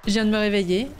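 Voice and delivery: ordinary delivery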